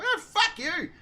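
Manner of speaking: very high, exasperated parting shot; retold parting insult. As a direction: toss it after her, pitched right up.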